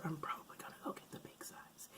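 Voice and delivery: soft unvoiced aside; whispering. Real, not acted. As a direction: whisper it like a secret. Aside about buying big size.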